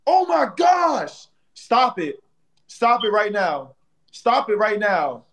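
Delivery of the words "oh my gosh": amazed. Real, not acted. Reacts to the gift.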